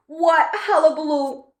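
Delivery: usual speaking voice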